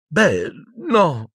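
put-on high, tearful girl's answer; sobbing. A narrator's reading. Hermione answering Umbridge, high feigned sob. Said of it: "singhiozzò Hermione".

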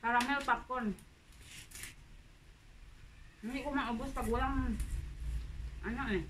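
talking between bites; chewing while talking. Real, not acted. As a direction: mouth partly full of popcorn. Seen 4: Puts popcorn in mouth around 1s, then chews while talking.

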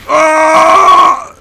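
sudden high cry of alarm; panicked yelp. Real, not acted. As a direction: yelp as you get hit. Panic during firefight.

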